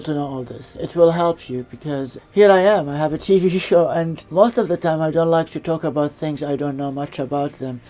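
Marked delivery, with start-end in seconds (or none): none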